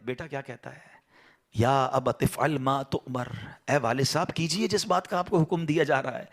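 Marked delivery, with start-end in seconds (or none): 1.5-3.3 slow melodic Arabic recitation; Quranic recitation. Acted. Preacher quoting Abraham's words from the Quran, slow recitation. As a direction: draw out each word as scripture.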